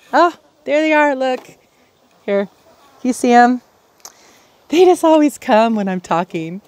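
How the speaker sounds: pointing them out with a sudden thrill; delighted to see chickens. Real, not acted.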